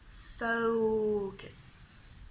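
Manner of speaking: FOCUS very slowly, OH drawn out; long OH glide. Shown as a demonstration. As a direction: stretch the gliding OH in FOCUS. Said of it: "I want you to make that sound".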